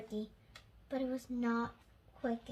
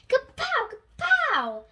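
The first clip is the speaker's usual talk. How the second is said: high, loud kapow; explosion shout; 12 semitones higher, 9 dB louder than usual. A narrator's reading. Narrator voicing the missile explosions, loud and high. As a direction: bang it out like a blast.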